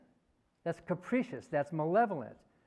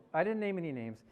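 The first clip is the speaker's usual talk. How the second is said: ordinary talk, nothing marked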